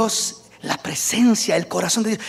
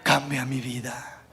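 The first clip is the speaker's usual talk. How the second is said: much lower, softer, slow; hushed; 5 semitones lower, 5 dB quieter than usual. Real, not acted. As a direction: drop to an intimate hush after the climax. Bajada tras el clímax. (voz baja e íntima, mucho más suave)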